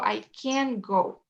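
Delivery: slow, CAN stressed and drawn out as the negative. Shown as a demonstration. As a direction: lean hard on "can", no t. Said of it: "pongo el énfasis en el can". (énfasis en «can», despacio)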